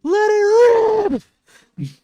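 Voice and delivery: full-throated high shout. Acted. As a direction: belt it out as a long, high battle cry. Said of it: "she's just shouting".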